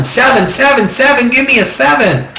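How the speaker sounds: high-pitched imitation of excited yelling; Mike's mock yell. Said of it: "Mike's yelling".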